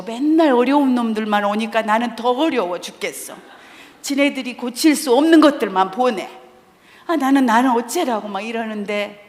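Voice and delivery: ordinary delivery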